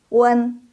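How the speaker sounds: Wen held high from rounded lips. Shown as a demonstration. Teacher has pupils watch the mouth, high held Wen. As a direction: round into Wen and keep it level.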